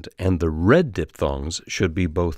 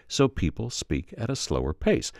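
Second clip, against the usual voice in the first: normal talking voice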